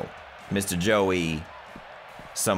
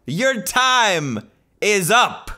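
hype commentator; 12 semitones higher than usual. Pretend. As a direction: call the match like a ringside announcer. Mock wrestling commentary.

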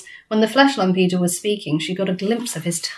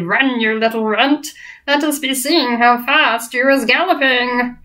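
mock giant jeer; 4 semitones higher than usual.

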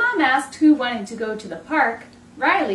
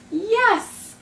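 excited yes; 8 semitones higher than usual. A narrator's reading. Riley answers the kid's invitation to play, excited. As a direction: burst out with delight.

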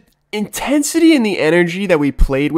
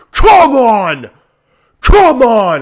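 louder, punchy cheer; real shout; 6 semitones higher, 5 dB louder, much slower than usual. Real, not acted. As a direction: pump up the crowd. Fan celebrating a goal.

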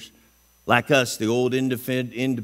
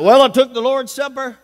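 mock excuse; 10 semitones higher, 8 dB louder than usual. Mocking a churchgoer's excuse.

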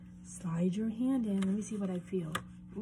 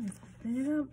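plain reading voice

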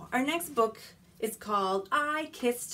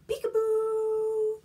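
high, bright surprise reveal; excited peekaboo; 12 semitones higher, 7 dB louder than usual. Acted. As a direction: pop out with delight.

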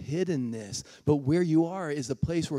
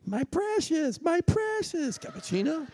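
lightly voiced, high "my precious" with a laugh; a playful hint of Gollum's craving; 14 semitones higher than usual. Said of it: "Lord of the Rings movies".

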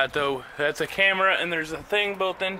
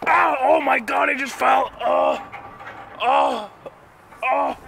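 high startled cry after falling; surprised yelp; 5 semitones higher, 4 dB louder than usual. Real, not acted. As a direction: yelp as you fall. Falls down the slide.

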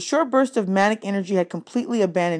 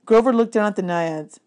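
plain reading voice

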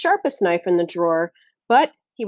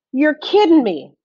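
high, loud disbelief; surprised; 7 semitones higher, 6 dB louder than usual. A narrator's reading. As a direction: blurt it out in shock. Foreman hears of alligators.

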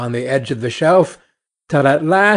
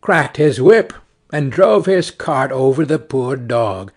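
ordinary delivery, nothing marked

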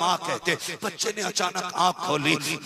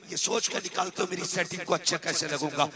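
ordinary talk, nothing marked

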